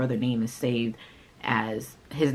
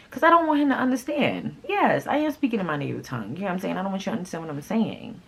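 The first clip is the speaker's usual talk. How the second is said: emphatic aside; 6 semitones higher than usual. Real, not acted. Insisting on speaking Creole.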